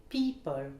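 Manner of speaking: PEO stressed in "People", falling second syllable; first-syllable stress. Shown as a demonstration. Teacher models a common word, stress on PEO in "People". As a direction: hit the start: PEO-ple. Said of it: "l'accentuation sur la première syllabe".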